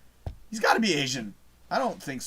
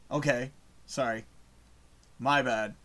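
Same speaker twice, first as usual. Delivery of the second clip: usual speaking voice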